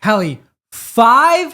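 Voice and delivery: slow, high, loud disbelief; incredulous. Real, not acted. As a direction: stretch it out in disbelief. Incredulous at her reaction.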